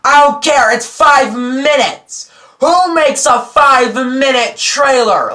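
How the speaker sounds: high, loud, slow exasperated outburst; mock exasperated rant. Vlogger ranting about the long Aquaman trailer, loud and exasperated. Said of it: "I know you're gonna say".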